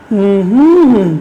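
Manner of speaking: closed-mouth hum that rises and falls; hummed stress pattern. Shown as a demonstration. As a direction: hum of a word's rhythm, swelling on the middle beat. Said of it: "আমার সাথে করতে পারো এভাবে?".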